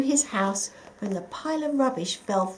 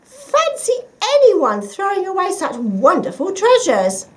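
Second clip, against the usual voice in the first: delighted; 10 semitones higher, 9 dB louder than usual. A narrator's reading. Missus Mouse delighted.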